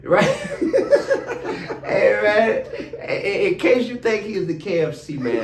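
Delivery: talking through laughter; words broken by real laughs; laughing. Real, not acted. Laughing at the KFC joke.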